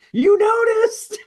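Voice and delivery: put-on very high, loud, drawn-out kid shout; mimicking an excited, yelling kid; imitated loud kid. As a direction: holler it slowly in a high child's voice. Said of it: "the kid just gets really loud".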